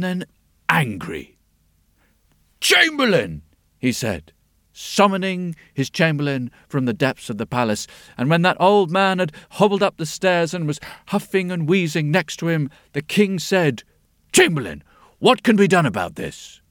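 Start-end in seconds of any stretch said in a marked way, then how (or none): 2.6-3.4 high, loud royal summons; stiff ceremonial announcing. Acted. King summons his chamberlain. 14.3-16.6 a touch of a king demanding; imperious royal question; stiff ceremonial announcing. Acted.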